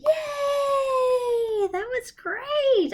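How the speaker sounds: high excited cheer; delighted praise. Acted. Cheer after the song.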